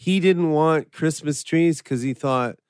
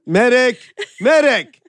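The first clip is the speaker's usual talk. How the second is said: louder, higher call for a medic; man down shout; 6 semitones higher, 4 dB louder than usual. Acted. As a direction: yell for the medic across a battlefield. Calling for medic.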